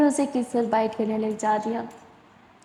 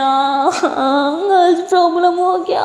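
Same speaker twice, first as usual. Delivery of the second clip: crying; 5 semitones higher, 5 dB louder, slower than usual. Acted. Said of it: "सारा रोना start करती है".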